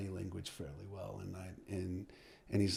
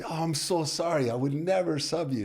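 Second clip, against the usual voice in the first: higher and louder, gushing sorry; relieved coach gushes an apology to his star striker; 8 semitones higher, 7 dB louder than usual. Retold or imitated.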